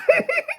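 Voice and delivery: a burst of high-pitched laughter; helpless high giggle; high squealing laugh. Real, not acted. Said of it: "I'm laughing so hard".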